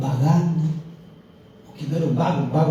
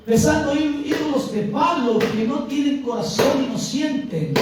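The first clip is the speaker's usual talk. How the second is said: impassioned preaching, higher than usual talk; predicación encendida; 10 semitones higher than usual. Real, not acted. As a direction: preach with urgent, swelling feeling. (prédica apasionada)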